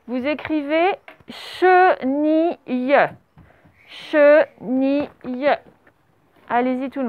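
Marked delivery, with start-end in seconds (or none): 1.3-2.6 CHENILLE drawn out slowly, syllable by syllable; syllable-by-syllable dictation. Shown as a demonstration. Teacher dictating a word to pupils, syllable by syllable. 3.9-5.1 CHENILLE drawn out slowly, syllable by syllable; syllable-by-syllable dictation. Shown as a demonstration. Teacher dictating a word to pupils, syllable by syllable.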